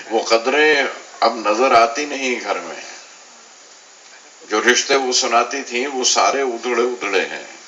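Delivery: deliberate, projected verse; poetry recitation. Acted. Poet mourns lost values of books for listeners, poetry recitation.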